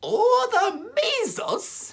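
very high, slow squeaky voice; squeaky doctor. Acted. Second doctor chiming in, very high and slow.

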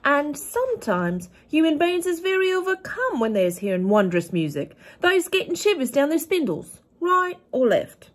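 big, high, loud giant voice, kindly and earnest; a friendly giant explaining wonders, up high and loud; the BFG. A narrator's reading. BFG voice reading story.

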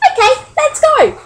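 very high, loud little-bird voice; squeaky, keen character voice; eager agreement. A narrator's reading. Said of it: "agreed Pip".

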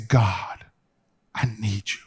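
private prayer. Shown as a demonstration. Preacher showing how to pray alone, whispered plea. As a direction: breathe it to God like nobody can hear.

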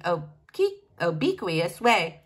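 plain reading voice

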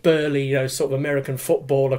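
usual speaking voice

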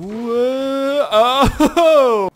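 long, high, loud whoa; drawn-out yell. Real, not acted. Player as the slingshot goes wrong, drawn-out yell. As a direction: let a startled yell stretch out.

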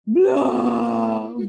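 loud, booming fish blub. Acted. Fish ending his song with a loud blub. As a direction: blow out one big bubble sound.

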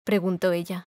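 plain reading voice

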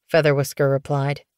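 ordinary delivery

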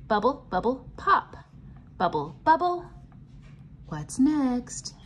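usual speaking voice

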